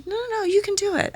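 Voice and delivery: high, soft, coaxing insistence; imitated encouragement. Director overruling a doubting actress, high coaxing.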